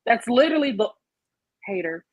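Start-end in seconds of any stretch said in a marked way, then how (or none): none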